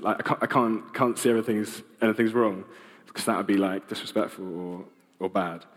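ordinary delivery

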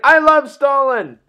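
high, loud declaration; over-the-top cheer; mock exuberant shout. Mock cheer echoing the Nazi shout.

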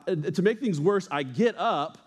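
ordinary delivery

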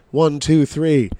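high, loud count to three; rally the crowd on the count; loud countdown. Retold or imitated.

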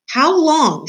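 high, loud furious demand; angry head housekeeper's roar. Acted. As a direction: roar at the servant. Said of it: "she roared".

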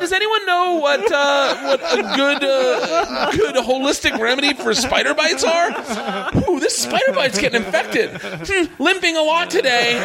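laughing high mock Roy voice; high clueless Roy. Reading Roy's Facebook posts to cohosts, high and laughing. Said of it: "then he's like".

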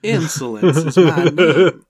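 hammed-up Southern introduction; Southern accent. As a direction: announce the name in a thick Southern twang. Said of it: "say the name Insolence with this voice".

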